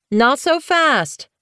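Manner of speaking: a queen calling after racing kits, slowly; called out. A narrator's reading. Blue Fur calls after the kits, slow and high. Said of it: "Blue Fur called".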